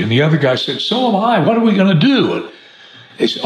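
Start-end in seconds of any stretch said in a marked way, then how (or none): 0.8-2.3 higher-pitched joke character, lightly played; storyteller voicing a thirsty pal; commiserating. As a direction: agree and ask helplessly, pitched up. Joke character shares his friend's desperate thirst.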